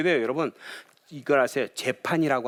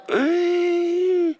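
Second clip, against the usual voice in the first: long, very high shocked wail; shocked cry; 6 dB louder, much slower than usual. Retold or imitated. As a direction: cry out in horror at the words.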